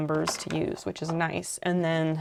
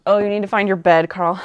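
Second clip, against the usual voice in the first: a loud, quick call to Carl; 9 dB louder, faster than usual. Real, not acted. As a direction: call across the room to Carl. Calling out to Carl.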